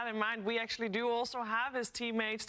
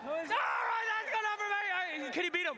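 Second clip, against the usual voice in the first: high, excited wrap-up; hype host; 7 semitones higher than usual. Real, not acted. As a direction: cheer it back to the panel. Host wraps crowd segment.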